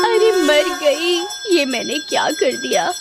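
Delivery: high, pleading witch cries; agonised wail. Acted. Witch burned by holy light begs Hanuman, agonised wail. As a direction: wail and beg for mercy. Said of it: "चुड़ैल उस रोशनी को बर्दाश्त नहीं कर पाती".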